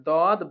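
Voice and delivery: "দদ" said thick from the side molars; "দদ" with an emphatic d and rounded vowel; heavy Dad. Shown as a demonstration. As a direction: make the first d of "দদ" full and heavy. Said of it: "মোটাভাবে আদায় হবে".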